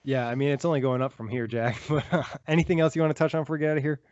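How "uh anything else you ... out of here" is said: usual speaking voice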